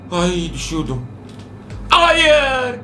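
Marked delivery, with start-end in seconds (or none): 1.9-2.8 high, drawn-out cries of dismay; panicked wail. Real, not acted. Player begs doctor not to come, wailing in panic. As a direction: wail desperately at the pursuer. Fails while the doctor approaches.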